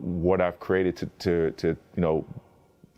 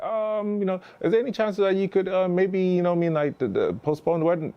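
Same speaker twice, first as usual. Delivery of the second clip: high, hesitant mock plea; mock pleading request; 11 semitones higher than usual. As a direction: ask sheepishly and pitched way up. Producers asking to delay wedding.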